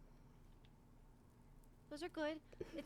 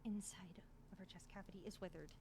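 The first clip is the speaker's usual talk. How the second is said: softer, whispered grim news; 7 semitones lower, 7 dB quieter than usual. Acted. Healer telling the party the chest is withered: softer whisper. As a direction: drop to a whisper at the worst part.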